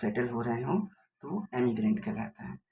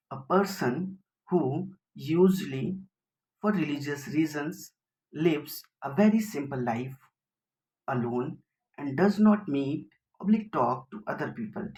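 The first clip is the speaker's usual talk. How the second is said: very slow, word by word, a little louder; dictating a definition; 2 semitones higher, 4 dB louder, much slower than usual. Shown as a demonstration. Teacher reads definition for students to copy, slow dictation. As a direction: read the definition out for students to note down.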